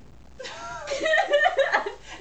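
loud high giggling fit; high laugh burst. Real, not acted.

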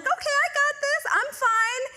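high, quick falsely confident; high chirpy I got this; mock confident. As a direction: sing it like you're sure you've got it. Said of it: "You, like, feel like".

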